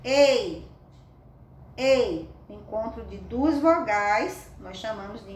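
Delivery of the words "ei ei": EI sounded alone, loud and separate; isolated vowel pair. Shown as a demonstration. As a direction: demonstrate the EI glide. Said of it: "tem um encontro vocálico".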